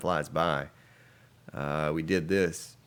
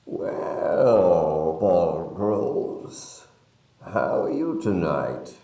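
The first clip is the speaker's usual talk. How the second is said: slow, drawn-out masked villain voice; Darth Vader CPAP voice; 2 semitones lower, 3 dB louder, much slower than usual. Acted. Streamer greeting a viewer, slow mock Vader voice. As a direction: stretch each word like a masked sci-fi villain. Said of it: "Oh, my Darth Vader C pap voice".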